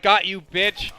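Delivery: spring the trap with a loud jeering gotcha; gotcha taunt. Retold or imitated. Prankster jumping out at his friend, loud taunting gotcha. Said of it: "is like".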